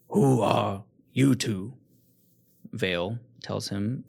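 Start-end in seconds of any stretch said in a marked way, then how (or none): 0.1-1.7 slow, loud, suspicious question; demanding lord. Acted. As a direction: press them for an answer.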